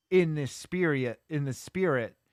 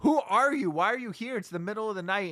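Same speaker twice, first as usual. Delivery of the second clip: startled guard; 8 semitones higher than usual. Retold or imitated. Security guard at the hospital door questions the van man, startled and high. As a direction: snap back like a startled night guard. Guard confronts stranger at night.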